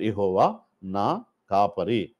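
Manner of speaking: put-on slow, word by word, as if teaching children; slow verse recitation. Shown as a demonstration. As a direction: spell out each word slowly for the class. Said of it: "ఐదు వేళ్ళకి ఒక్క పేరు". (నెమ్మదిగా పదం పదంగా చెప్పడం)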